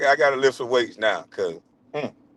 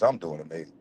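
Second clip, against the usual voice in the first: smug and satisfied; 11 semitones lower, 7 dB quieter than usual. Real, not acted. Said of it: "Y'all can hear it in my voice".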